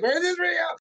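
high-pitched mock disbelief; retold disbelief. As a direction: jump up high on a doubting question. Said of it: "They're like".

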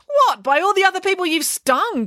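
high, incredulous question; indignant retort. A narrator's reading. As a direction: throw the question back in disbelief. Stung frog retorts indignantly.

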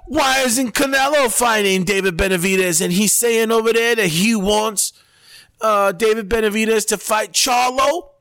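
high-pitched ranting pundit impression; Stephen A. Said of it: "he's like".

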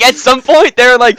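ordinary delivery

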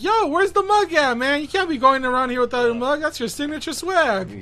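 mock hype bro asking; imitating a guy. Retold guy demanding mug.

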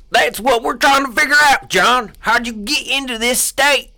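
high, loud mock interrogator; loud interviewer act; hammed-up interviewer. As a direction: press him hard. Voicing interviewer.

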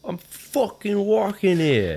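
high, drawn-out New York holler; mock New York street yell; New York accent. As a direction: stretch it out like a brash New Yorker. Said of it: "do well in New York, New Jersey".

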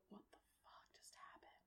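stunned unvoiced whisper; whispered aside. Game master acting the companions' reaction, standing there, whispering. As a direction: whisper it under your breath in disbelief. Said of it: "like, whispering over to the side".

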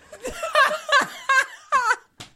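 loud, high peal of laughter; burst of laughter. Real, not acted. Co-host hearing an ogre story, bursting out laughing.

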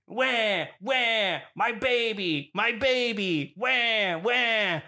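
high, drawn-out fake wailing; mock crying. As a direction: imitate a badly faked bawl, each cry falling away. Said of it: "she was crying".

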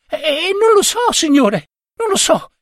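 put-on very high, stammering boy's voice; scared boy. A narrator's reading. Errand boy to his angry boss, high and stammering. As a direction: stutter it out high and helpless. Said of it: "disse il ragazzo".

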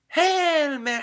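high, loud mock nun proclaiming; imitating the nun. Teacher nun showing children the prayer, high and loud. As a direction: play the little Irish nun reciting grandly. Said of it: "so she went through and going, like".

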